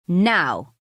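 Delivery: loud, abrupt command; barked order. Acted. Father ordering his girls out, barking. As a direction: snap it out as an order. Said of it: "he barks".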